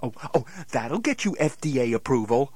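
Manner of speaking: normal talking voice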